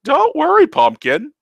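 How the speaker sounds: put-on old uncle teasing his niece in a silly voice; teasing old Taco. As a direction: tease her like a cheeky ancient uncle.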